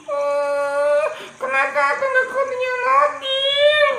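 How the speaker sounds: high, tearful complaint; sad whine. Acted. Motorbike owner whines he has no car, tearful. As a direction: whimper about the car. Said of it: "Dia sedih".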